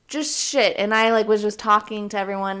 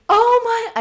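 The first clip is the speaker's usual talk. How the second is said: much higher and louder pained shriek; retold scream; 9 dB louder than usual. Host recalling her baby brother biting her finger, pained shriek. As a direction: scream out in sudden pain. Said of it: "started screaming in the middle of Cece's".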